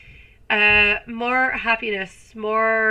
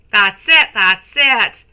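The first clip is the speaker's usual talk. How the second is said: much louder encouraging that's it, rising then falling; cheering; 11 dB louder, slower than usual. Real, not acted. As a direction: cheer them on loudly. Cheering on a viewer.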